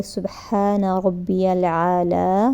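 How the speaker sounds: unhurried tasbih, each word drawn out; slow, calm recitation. Shown as a demonstration. Teacher demonstrating how to say the ruku tasbih: very slow and calm. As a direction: let the praise unfold slowly. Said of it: "اس طرح آرام سے".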